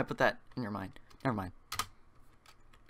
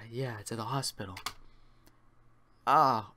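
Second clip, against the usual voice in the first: ordinary delivery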